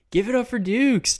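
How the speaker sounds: loud, high hype-man callout; hype shout. Acted. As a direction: pump the crowd up for him. Hyping up a chat member.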